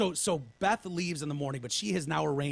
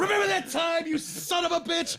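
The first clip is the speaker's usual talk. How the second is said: mock shouted threat; 12 semitones higher than usual. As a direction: shout it like a raving lunatic. Quoting drugged threat.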